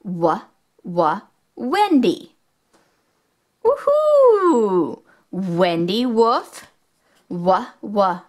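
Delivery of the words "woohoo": high, loud woohoo cheer. Acted. To the kids watching, an excited high cheer. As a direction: celebrate finding the pup.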